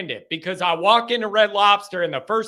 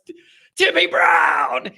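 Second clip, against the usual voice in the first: high, drawn-out sportscaster shout; Tony Roberts impression; 7 semitones higher, 3 dB louder than usual. Host recalling a beloved announcer's call, impersonated shout. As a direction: call the name out long and high. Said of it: "you know that voice".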